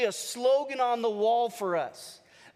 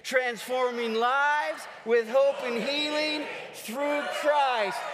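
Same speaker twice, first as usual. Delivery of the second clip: slow stadium chant, held-out words; loud and proud; slower than usual. Real, not acted. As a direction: cheerleader rallying a whole crowd. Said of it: "Do it loud and proud".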